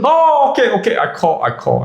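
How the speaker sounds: high startled okay that fades away; meek guy caving in a hurry; flustered agreement. Retold or imitated. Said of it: "And he goes".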